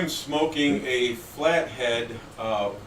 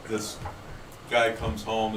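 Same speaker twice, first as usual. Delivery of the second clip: normal talking voice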